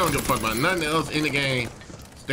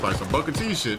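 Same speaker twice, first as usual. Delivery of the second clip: usual speaking voice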